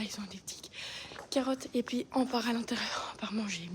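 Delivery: soft whisper near the horses. Real, not acted. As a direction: keep it to a whisper.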